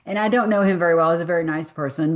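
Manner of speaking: usual speaking voice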